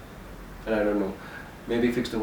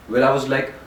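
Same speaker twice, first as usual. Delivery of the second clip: ordinary talk, nothing marked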